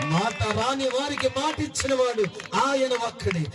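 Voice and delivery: high, sing-song sermon cadence; fervent Telugu pastor pleading with the congregation, sung preaching; impassioned preaching. Acted. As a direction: preach it out to the crowd, half chanting. Preacher's rising litany.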